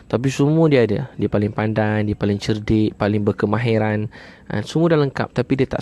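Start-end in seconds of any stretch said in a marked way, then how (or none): none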